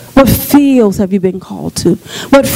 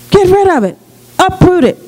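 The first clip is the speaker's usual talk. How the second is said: exhorting; 7 semitones higher than usual. Acted. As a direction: drive it out with force. Preacher exhorting.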